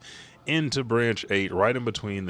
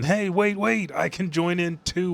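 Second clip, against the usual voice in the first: much higher, loud eager shout; eager call; 10 semitones higher, 4 dB louder than usual. Retold or imitated. Road eagerly calls that he wants to join the attack, high and loud. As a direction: jump in, keen not to miss out.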